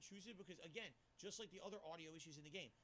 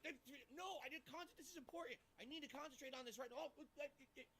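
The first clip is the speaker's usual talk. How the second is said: flustered; 6 semitones higher than usual. Retold or imitated. Acting flustered under noise.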